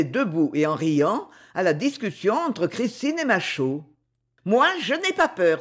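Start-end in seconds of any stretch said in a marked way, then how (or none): 4.5-5.6 higher, bold little exclamation; exclaiming boldly. Acted. Gladys volunteering for the strongman's lift, bold exclaim. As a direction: toss it out, showing off her nerve. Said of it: "s'écria Gladys".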